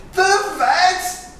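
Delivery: very high, loud horrified shout; shocked shout. Acted. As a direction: shriek the question in alarm. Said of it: "shouted Titus".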